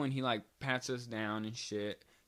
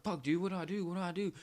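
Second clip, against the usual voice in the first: high panicked cry of what to do; 7 semitones higher, faster than usual. Retold or imitated. Caught with the blunt, panicked high plea. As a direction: retell the panic, voice jumping higher. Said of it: "I'm like".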